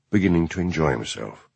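usual speaking voice

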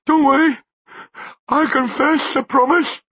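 very high and loud, terrified; desperate promise. A narrator's reading. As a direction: swear it in panic.

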